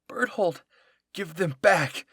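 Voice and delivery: high, loud demand; fierce yell. Acted. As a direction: yell it at him. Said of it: "I heard Mikasa yell".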